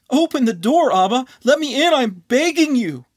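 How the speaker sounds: high, loud, frantic cry; desperate begging. Acted. Anon begging Abba through the door, high and loud in desperation. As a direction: plead as if your life depends on it.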